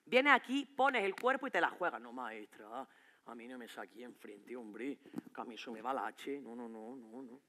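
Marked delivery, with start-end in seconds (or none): 2.0-7.4 very soft, under the breath; muttered aside. Acted. Teen quietly refuses the teacher, muttering.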